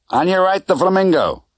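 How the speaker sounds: a hint of a breezy Vegas cabbie; driver Correa. A narrator's reading. Driver Correa voiced by narrator.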